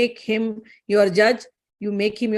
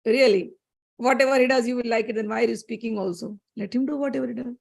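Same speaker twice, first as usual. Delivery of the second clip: ordinary delivery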